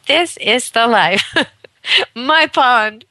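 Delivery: lightly voiced happy dog; dog's inner voice. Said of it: "they're like".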